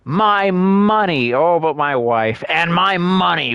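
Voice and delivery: high, slow, mock-wailing over wife and money; mocking whiny nobleman. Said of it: "he's like, / I'm making fun of this".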